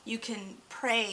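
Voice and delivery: ordinary delivery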